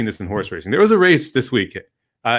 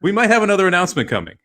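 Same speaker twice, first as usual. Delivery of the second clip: mock announcer; 8 semitones higher than usual. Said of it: "they come on the loudspeaker".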